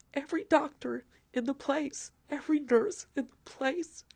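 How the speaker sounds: excited, full voice; overjoyed. Real, not acted. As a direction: let the joy burst out. Joyous hallway moment recalled.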